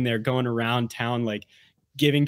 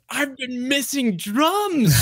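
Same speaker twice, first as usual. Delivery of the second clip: mock exaggerated sigh; 11 semitones higher, slower than usual. Acted reaction to new album.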